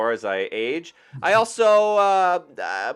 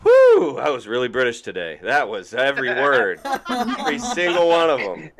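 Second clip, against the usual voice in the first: a lightly done weary Brit exhaling after a long day; hint of an exhausted British accent; tired British; 5 dB louder than usual. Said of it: "take off your clothes and go".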